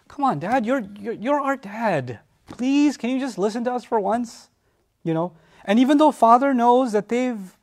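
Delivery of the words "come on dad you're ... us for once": pleading sons. Retold or imitated. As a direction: plead gently and hurt with Dad. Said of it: "the Arabic is capturing something".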